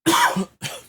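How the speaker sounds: sharp mock cough, high and loud; staged cough to sound ill; fake cough. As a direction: hack out a put-on sick cough. Faking sick on the phone.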